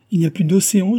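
put-on young woman bursting out with amazing news; excited exclamation. A narrator's reading. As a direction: blurt out the astonishing news. Susanna's astonishing revelation.

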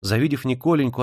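ordinary delivery, nothing marked

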